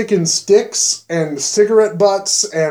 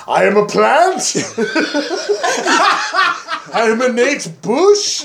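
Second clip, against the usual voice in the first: put-on drunken voice; 6 semitones higher than usual. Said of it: "in the most drunken Nate voice".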